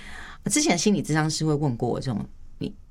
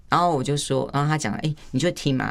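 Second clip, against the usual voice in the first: usual speaking voice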